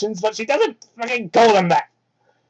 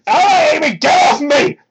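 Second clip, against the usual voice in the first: high, loud mock outburst; exclaiming; 8 semitones higher, 6 dB louder than usual. Sonic pushing Amy off his seat, loud mock outburst. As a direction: shove the words out in hammed-up annoyance. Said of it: "he exclaimed".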